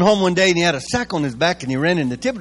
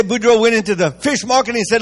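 normal talking voice

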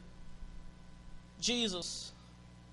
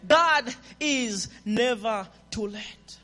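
slow repeat; much slower than usual. Real, not acted. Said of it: "Did you hear what I said?".

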